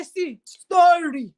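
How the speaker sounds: slow, drawn-out scornful "See, story."; drawn-out mock scorn; mocking sneer. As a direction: sneer at the dreamer slowly. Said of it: "they say".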